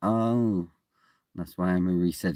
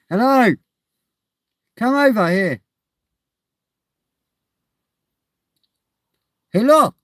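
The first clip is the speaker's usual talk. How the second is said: high loud call to get attention; loud high-pitched call; calling out loudly; 13 semitones higher, 8 dB louder than usual. Real, not acted. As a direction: shout across to a stranger. Calling out to man outside.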